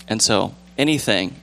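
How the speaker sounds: normal talking voice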